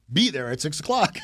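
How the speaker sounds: loud, high-pitched mock command; aggressive order. Showing how a daughter reads the text, aggressive. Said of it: "Not yelling with an exclamation mark".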